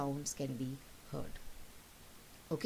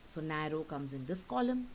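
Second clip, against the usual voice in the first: usual speaking voice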